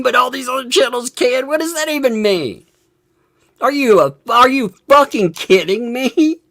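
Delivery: high, heated, incredulous rant; worked up. Real, not acted. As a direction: challenge him in exasperated disbelief. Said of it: "I got emotional for a minute".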